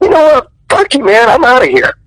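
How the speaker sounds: high-pitched, fed-up kiss-off; retold angry walk-out. Actor retells quitting the audition on the director, angry. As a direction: act out storming off, pitch up.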